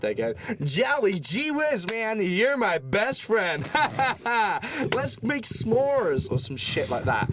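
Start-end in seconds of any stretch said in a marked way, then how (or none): 0.6-6.3 high, slow, hammed-up cheery American voice; mock a gushing American tour guide. Tour guides cheering on a bus, in a high, slow cheesy American voice.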